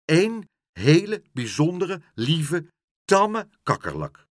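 high, loud, word-by-word emphasis; insistent pleading. A narrator's reading. Pluk defending his tame cockroach, insistent. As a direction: stress each virtue of the pet.

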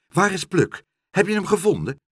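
high, loud, urgent questions; anxious questioning. A narrator's reading. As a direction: blurt out the worried questions. Pen anxiously asks for news first.

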